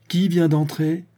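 father demanding who came in; insistent demand. A narrator's reading. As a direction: press the question sharply. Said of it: "demanda-t-il de nouveau".

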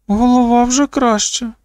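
високий дівочий голос; оголоси це високо, по-дитячому; оголошення дівчинки. A narrator's reading. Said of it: "оголосила Кеті". (високим голосом дівчинки)

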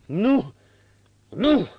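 loud, high anguished cries; betrayed husband crying out alone; muffled cry. A narrator's reading. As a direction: cry out in despair and rage. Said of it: "strigă cu voce surdă".

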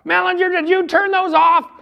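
lieutenant rounding on the recruit, yelling; accusing lieutenant. Retold or imitated. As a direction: shout the name like you've caught him out.

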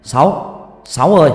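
loud call across the house. Acted. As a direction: shout for the servant Sáu. Master calls servant.

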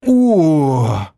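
a long, stretched-out oh; drawn-out oh. A narrator's reading. A nameless player reacts, drawn-out impressed oh. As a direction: let the oh drag out, impressed. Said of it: "протянул игрок".

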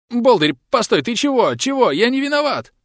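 high, frightened wailing plea; wailing lament. Acted. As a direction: wail your innocence in fear. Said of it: "запричитал мужик". (причитая, в испуге)

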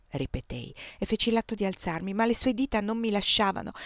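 usual speaking voice

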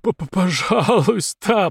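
медленно, заикаясь, с бульканьем; выдави мольбу сквозь кровь; булькающая мольба. A narrator's reading. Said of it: "пробулькал подонок". (булькающая мольба с заиканием)

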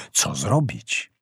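plain reading voice

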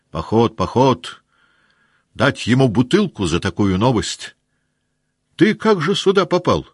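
excited news. Acted. Officers exclaiming at Zherkov's news, excited. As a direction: celebrate the march.

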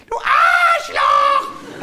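very high, slow, loud screech; child shout. Retold or imitated. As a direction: squeal it like a furious kid. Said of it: "hat er gesagt". (kreischendes Kind)

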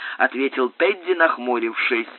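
usual speaking voice

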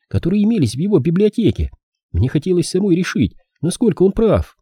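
put-on high-pitched earnest girl voice; young woman character. Phyllis explains her reading to the detective, high earnest girl.

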